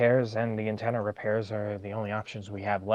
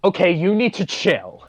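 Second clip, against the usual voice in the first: loud, high telling-off; sharp rebuke; 10 semitones higher, 10 dB louder than usual. Acted. Telling a companion to calm down, loudly rebuking. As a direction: raise your voice and scold.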